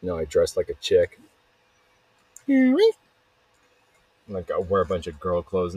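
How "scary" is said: very high bird shriek; blackbird screech. Pretend. Blackbird interrupting the story, screeched squawk. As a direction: squawk it in a piercing pitched-up voice.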